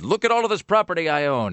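high, lordly boast of a proud dog; a pompous dog surveying his land; smug dog voice. Retold or imitated. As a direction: lord it over the yard like a smug dog.

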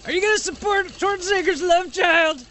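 high, strained mock shout from the back; imitated heckler. Reporter imitates a man at the back of a press conference, mock shout. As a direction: call out a heckler's question at a high pitch. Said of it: "that guy in the back going".

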